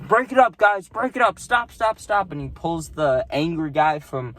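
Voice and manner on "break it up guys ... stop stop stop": higher, loud commanding break-it-up call; ticket guy breaking up a fight. Retold or imitated. Ticket guy pushing in to split up the two fighters, high and loud. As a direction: bark at the brawlers to stop. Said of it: "he's like".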